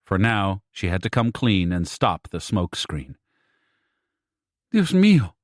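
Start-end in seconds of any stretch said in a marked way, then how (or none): 4.7-5.3 a high, loud gasp of alarm; a mother crying out in alarm; exclaiming in shock. A narrator's reading. Mother on the phone hears worrying news, high alarmed exclamation. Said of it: "Sylvia exclaimed over the phone".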